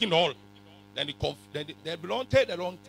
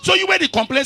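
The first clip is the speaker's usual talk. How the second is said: high shout; 12 semitones higher, 9 dB louder, faster than usual. Acted.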